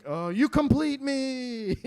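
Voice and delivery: high, drawn-out mock romance; corny movie line. Husband grasping for an answer for wife, hammily romantic. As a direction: ham up a slow romantic declaration. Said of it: "any stupid movie line I could remember".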